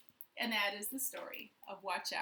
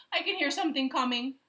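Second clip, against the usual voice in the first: fast, louder whine; whined; 4 semitones higher, 9 dB louder, faster than usual. A narrator's reading. Chimpanzee whines in fear, fast. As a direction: rush it out in a whine. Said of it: "whined the chimpanzee".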